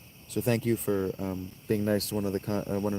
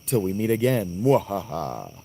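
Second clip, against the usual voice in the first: louder, hammy villain voice; slow theatrical villain menace; 5 dB louder than usual. Acted. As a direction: loom over the heroes, gloating. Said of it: "in the voice of Thanatos".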